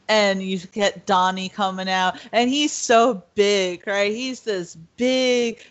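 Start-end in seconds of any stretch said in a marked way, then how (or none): none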